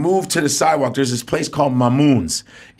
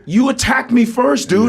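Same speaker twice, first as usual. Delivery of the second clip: protesting; 8 semitones higher than usual. Retold or imitated. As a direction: insist he started it. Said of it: "I go".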